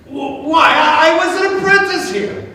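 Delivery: put-on stammering, rising excited old man; surprised recognition. A narrator's reading. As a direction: Scrooge astonished to see his old workplace. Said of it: "and Scrooge said".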